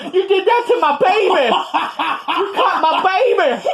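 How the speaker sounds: mock the angry mom, high-pitched and slowed, half laughing; upset mother. Retelling the bleeding wrestler's mother confronting him, high laughing mock wail. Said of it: "she's saying stuff like".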